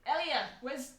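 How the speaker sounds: shouting across the library; calling out. Acted. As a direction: call out to a colleague. NPC calling to colleague.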